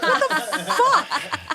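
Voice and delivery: loud, high, drawn-out retold outburst with laughter; hammed-up laughing exclamation; incredulous retort. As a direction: blurt it out in mock disbelief, laughing. Acting out her shocked reply.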